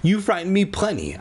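high-pitched insistent exclamation; exclaiming. A narrator's reading. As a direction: keep the protest pitched high, not shouted. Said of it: "the woman exclaimed".

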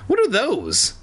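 high, lightly voiced curious question; curious girl. A narrator's reading. Alex following Zacky to the desk, high and curious. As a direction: lift it up with curiosity. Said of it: "Alex asked".

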